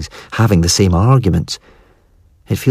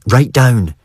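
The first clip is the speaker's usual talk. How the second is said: urgent; 5 semitones higher than usual. A narrator's reading. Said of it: "she says urgently".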